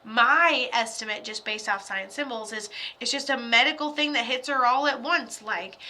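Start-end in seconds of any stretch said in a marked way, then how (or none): none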